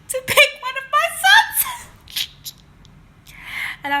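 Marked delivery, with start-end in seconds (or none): 0.0-1.9 high, loud, cracking up mid-quote; can barely get the quote out for laughing; laughing retelling. Retold or imitated. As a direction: squeal the quote through your laughter. Cracks up while quoting Bob's offer.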